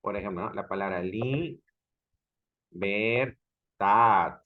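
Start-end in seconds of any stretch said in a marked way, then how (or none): 2.7-4.4 "libertad" very slow, with gaps between LI, BER and TAD; syllable-by-syllable demo. Shown as a demonstration. Teacher syllabifying the word for students, separated "libertad". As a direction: break "libertad" into three drawn-out syllables. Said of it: "lo vamos a silabiar".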